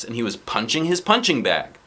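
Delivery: plain reading voice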